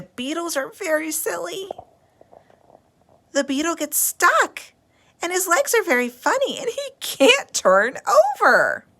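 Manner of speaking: a little sister thrilled by a stuck beetle, excited; excited little girl. Acted. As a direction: bubble over about the beetle. Lola gushing about beetles.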